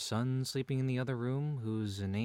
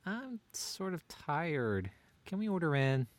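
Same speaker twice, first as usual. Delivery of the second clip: slow, high whining plea; drawn-out tired whine; whiny; 6 semitones higher, slower than usual. Acted. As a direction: whine it out lazily. Whining to avoid cooking.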